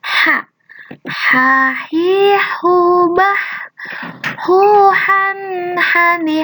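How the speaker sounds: gentle h from the throat, no hiss; soft throat h for the class. Shown as a demonstration. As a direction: breathe the ha out softly from the throat. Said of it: "diucapkannya sangat lembut".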